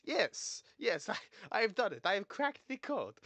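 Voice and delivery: loud, high, triumphant mock Polish accent; attempted Polish accent. Learner sure of having cracked it, triumphant fake Polish accent. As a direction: crow in a hammed-up Polish accent. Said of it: "Polish accent like this".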